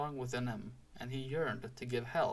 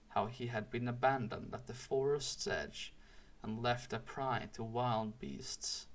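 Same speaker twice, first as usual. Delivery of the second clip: usual speaking voice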